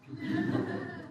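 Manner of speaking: a breathy little laugh at himself; quiet amused chuckle; soft laugh. Real, not acted. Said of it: "Sorry, I'm doing Johnson".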